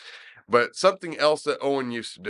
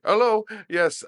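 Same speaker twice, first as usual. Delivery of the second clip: higher-pitched mock Indian accent; 9 semitones higher, 4 dB louder than usual. [name] on the prank call as the manager, mock Indian accent. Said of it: "Parsi or Indian accent".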